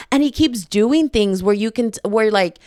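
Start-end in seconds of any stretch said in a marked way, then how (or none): none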